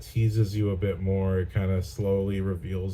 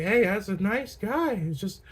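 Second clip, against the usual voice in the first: high-pitched mock nice-guy voice; hammed-up sweet guy impression; Jon Bernthal as nice guy; 11 semitones higher than usual. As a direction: play harmless and innocent, pitched way up. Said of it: "what would Jon Bernthal look like?".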